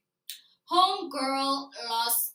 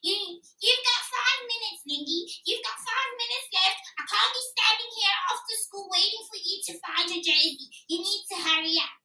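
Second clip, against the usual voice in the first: higher, louder nagging teacher voice; impatient teacher; 8 semitones higher, 5 dB louder than usual. Retold or imitated. Teacher at the door tells the girl to hurry, nagging loudly. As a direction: play the teacher hurrying a dawdling pupil. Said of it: "Missus Rick is outside that door".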